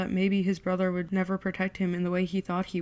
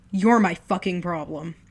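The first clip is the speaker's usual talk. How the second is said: louder bitter snap; angry; 5 dB louder than usual. Acted. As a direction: spit it back, furious. Brothers' furious quarrel.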